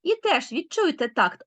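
ordinary talk

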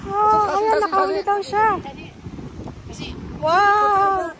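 high, bright excited chatter; excited anime fan. Real, not acted. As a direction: gush over the catch like a fan. Sees a fish being caught.

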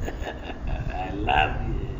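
very slow, low and soft; mock a smothering parent; doting parent. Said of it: "and they go".

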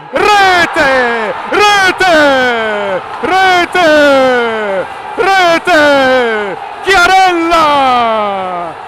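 long held goal scream, high and loud; breathless italian goal commentary. Real, not acted. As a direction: stretch out rete and the scorer's name. Winning goal call.

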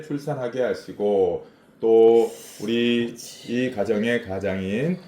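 steady heartfelt praying voice; earnest prayer. Real, not acted. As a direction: pray sincerely for the family. Leads family prayer. (간절한 기도하는 목소리)

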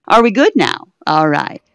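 loud check-in; loud call. Real, not acted. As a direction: call across the room. Calling out loudly.

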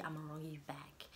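voiceless whispered threat, drops away; sly whisper. Real, not acted. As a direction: whisper it slyly like a promise. Sassy threat about spite.

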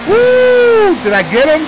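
whooping yell into the mic; excited yell. Real, not acted. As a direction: shout with surprise at the win. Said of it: "Sorry if I'm yelling into my microphone".

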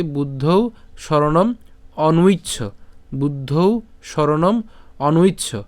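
অনুইচ্ছ said as onu-itch-chho, slowly joined to শরণম; slow, careful বুদ্ধৌ শরণম অনুইচ্ছ; Sanskrit pronunciation. Shown as a demonstration. Teacher joining the words slowly for students. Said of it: "তাহলে একসাথে যদি উচ্চারণ করি".

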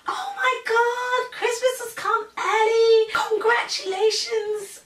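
very high, loud, drawn-out squeal; imitating an overjoyed well-wisher; excited congratulations. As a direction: gush slowly in a high mock-delighted voice. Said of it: "she was so excited for me".

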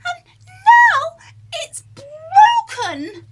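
very high, loud tearful cub wail; crying Baby Bear. Acted. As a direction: wail like a little bear whose breakfast is gone. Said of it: "cried Baby Bear".

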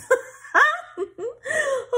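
high, bright burst of laughter; embarrassed laugh. Real, not acted. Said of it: "too old to get the hiccups".

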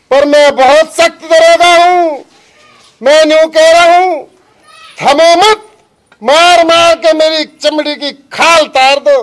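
loud, hard-driving officer's orders; forceful command. Acted. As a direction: declare it loudly, daring them to continue. Officer orders his men on, defiantly.

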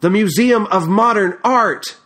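high, loud indignant cry; angry shout. Acted. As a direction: fling it out in outrage. Outburst continues, loud.

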